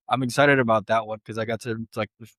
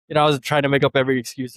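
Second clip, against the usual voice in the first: usual speaking voice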